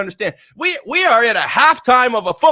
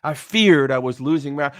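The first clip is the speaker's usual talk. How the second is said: normal talking voice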